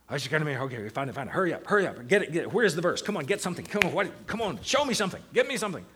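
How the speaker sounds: impatient, urgent rush, climbing as it goes; mock-frantic muttering to oneself that builds into demands; frantic hurrying. Impatient reader hurrying himself to find a verse, frantic and rushed. Acting out his own rushed Bible reading.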